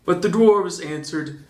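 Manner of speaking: ordinary delivery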